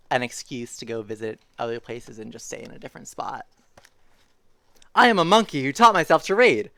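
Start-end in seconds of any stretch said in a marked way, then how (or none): 4.9-6.7 louder, higher storybook proclamation; animated story reading. A narrator's reading. Reader voices the book's monkey confession, animated read-aloud. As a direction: announce the absurd line with gusto. Reads silly book line aloud.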